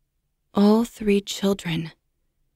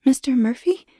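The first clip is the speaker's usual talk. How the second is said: put-on a questioning, lightly raised young woman's voice; hesitant babysitter; 6 semitones higher than usual. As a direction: ask the name unsure who's there. Babysitter answers the phone.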